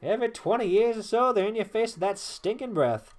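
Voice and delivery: put-on lively, swooping southern-accented yarn; old-timer storyteller. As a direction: recount old battles with relish. Voices Traherne ranting about goblins.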